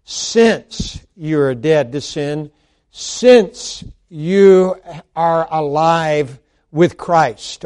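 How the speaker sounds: slow, weighted on each word; slow emphatic reading. Shown as a demonstration. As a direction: read the translation with deliberate stress. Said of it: "could legitimately be translated".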